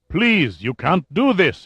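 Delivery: slow, high-pitched plea; pleading. A narrator's reading. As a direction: beg him to stop, in despair. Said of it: "he pleaded".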